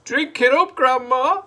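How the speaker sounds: high, loud gleeful goading; gleeful Mister Cranky. A narrator's reading. Mister Cranky urging Grandma to drink, high and gleeful. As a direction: egg her on with glee. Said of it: "grinning hugely".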